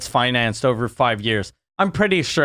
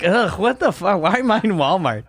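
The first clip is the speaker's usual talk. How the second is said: disgusted groan; 5 semitones higher than usual. Retold or imitated. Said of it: "You're like".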